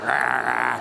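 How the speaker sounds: a low growl, half-joking, like a sulky CFO; mock grumpy growl. Speaker teasing grumpy CFOs in the crowd, mock growl. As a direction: growl it like a grumpy boss, just for a laugh. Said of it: "grumpy looking like that".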